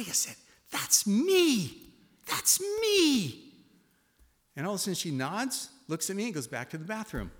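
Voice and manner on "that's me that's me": high, loud, building to a shout; exclaiming. Retold or imitated. Husband telling his wife the verse is about him, rising shout. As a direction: cry it out in wonder.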